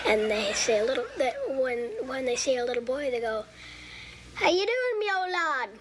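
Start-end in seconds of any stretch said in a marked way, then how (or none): 4.3-5.7 put-on a child's playful Irish accent greeting. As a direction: say it like a cheery Irishman. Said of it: "this is how they say".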